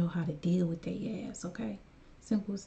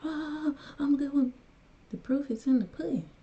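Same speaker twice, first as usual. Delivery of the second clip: higher, louder smug mock boast; mocking self-praise; 8 semitones higher, 3 dB louder than usual. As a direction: lampoon someone fishing for approval, louder. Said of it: "but I'm not like".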